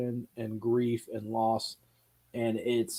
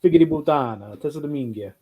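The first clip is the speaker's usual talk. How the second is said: Italian swears said with relish; cussing in Italian at the airport. Shown as a demonstration. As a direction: spit out Italian curses like a native. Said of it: "started cussing in Italian".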